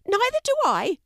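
high-pitched fish acting brave; putting on bravery. A narrator's reading. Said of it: "sounding braver than he felt".

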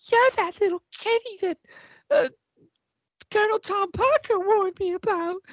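very high, breathy-sounding bird lady; sexy pheasant voice. Retold or imitated. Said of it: "your sexy pheasant voice is really quite funny".

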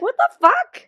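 high, loud "what the fuck"; shocked outrage; outraged disbelief. Retold or imitated. Sam pissed, acted.

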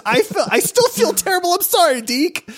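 high, laughing guilt; laughing apology. Real, not acted.

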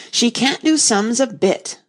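slow, high, loud, every word pressed; great emphasis. A narrator's reading. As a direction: hammer each word home. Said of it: "with great emphasis".

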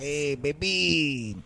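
slow, smooth flirting; flirty come-on. Retold or imitated. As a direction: stretch it out like a cheesy flirt. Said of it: "He's like".

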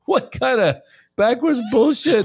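high, loud, drawn-out disbelief; mock outrage. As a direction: exclaim it slowly in comic indignation. Comic outrage at the loophole.

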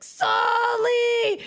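put-on high, loud drunken bellow of a name; screaming. Drunk boyfriend storming up to her apartment, imitated scream. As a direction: yell it like a drunk storming upstairs. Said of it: "he comes screaming up the thing".

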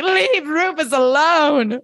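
high, drawn-out wail; hammed-up fan outrage; mock pleading protest. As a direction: stretch it out in exasperated protest. Acted protest at the show.

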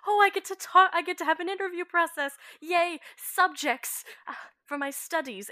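high, bright giddy delight; gleeful. Acted. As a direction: squeal it like a kid with a new toy. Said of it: "gleeful little smile".

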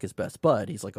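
ordinary talk, nothing marked

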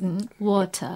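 quizzical British "water", crisp t and no final r; British correction. Retold or imitated. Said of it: "って言うからね".

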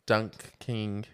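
"Dunking" split into DUNK and KING; split pronunciation. Real, not acted. Clarifying the name for a confused co-host, "Dunking" as dunk-king. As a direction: say "Dunking" as two separate words, dunk king. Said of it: "That's why I said dunking".

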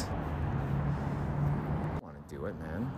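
barely audible, slow, breathy under the breath; slow dreading whisper to himself. Real, not acted. Dreading stabbing a dog.

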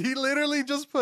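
high, loud, laughing through the words. Real, not acted. Retelling a coworker's nickname, laughing high and loud. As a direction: laugh while telling it.

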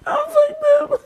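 high-pitched laughing cry. Real, not acted. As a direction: squeal it through laughter. Laughing at himself while high.

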